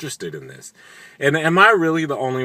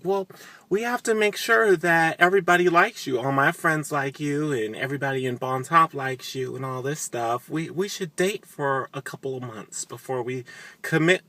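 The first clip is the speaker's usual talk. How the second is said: higher, coy put-on voice; mock Sookie; 5 semitones higher than usual. Sookie stalling Warlow at the wedding, in a coy mocking voice. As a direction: play her as sweetly dodging.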